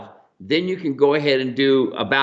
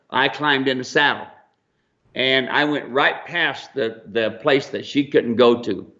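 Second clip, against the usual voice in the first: ordinary talk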